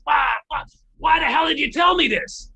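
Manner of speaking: high, loud, exasperated imitation. Guitar legend asks why he was told, exasperated impression. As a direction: put on a protesting old-timer's shout. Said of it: "In [name]'s voice, he goes".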